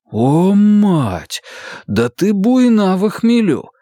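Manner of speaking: mock-astonished; bewildered exclamation. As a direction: gasp it out in disbelief. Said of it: "растерянно проговорил я".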